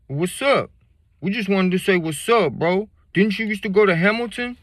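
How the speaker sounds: put-on high, loud friendly drunk voice; drunk homeboy. Drunk classmate greeting the teller, high and loud. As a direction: play the tipsy old classmate. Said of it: "Homeboy pulls up his shirt".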